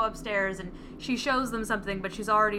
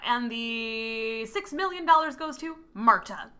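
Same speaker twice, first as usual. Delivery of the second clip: slow, deliberate reading of the last bequest; drawn-out punchline; 2 semitones higher, slower than usual. Retold or imitated. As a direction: stretch it out for comic effect. Lawyer voiced reading the will.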